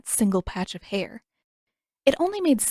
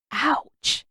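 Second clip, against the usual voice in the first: sudden pain; 6 semitones higher than usual. A narrator's reading. Arms aching after throwing hands.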